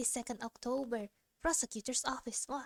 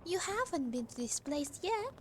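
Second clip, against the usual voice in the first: put-on high girl voice; 8 semitones higher than usual. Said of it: "Oh, Iris said".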